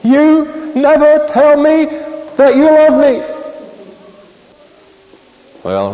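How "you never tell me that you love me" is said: much higher, slow, tearful wife; higher, slower, louder tearful wife's plea; choked sobbing plea. Retold or imitated. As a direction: choke the words out through sobs. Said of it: "And through sobs, she choked back".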